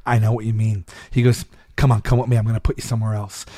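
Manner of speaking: low-key imitation of a kindly guard; empathetic. Security guard to dancer, imitated empathy. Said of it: "the guy was empathetic".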